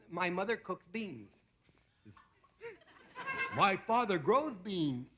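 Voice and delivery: put-on slow dopey kid, barely changed voice; lightly voiced moron. Comic slowly delivering the moron's beans punchline. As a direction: drag it out like a slow-witted boy. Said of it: "I gotta play three parts ... the moron".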